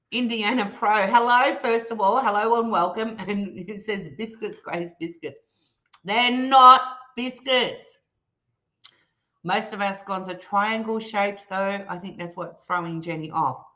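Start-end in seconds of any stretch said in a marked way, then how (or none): 6.1-8.0 slow, louder protest; slow emphatic denial. Real, not acted. Telling Grace they aren't biscuits, slow and firm. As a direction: drag it out, firmly. Rebutting chat's biscuit claim.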